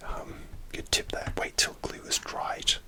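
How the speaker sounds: ordinary delivery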